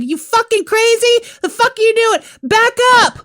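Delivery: imagined yell. Pretend. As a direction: yell at the driver to back up. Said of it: "been like".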